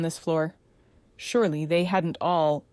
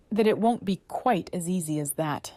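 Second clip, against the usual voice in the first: plain reading voice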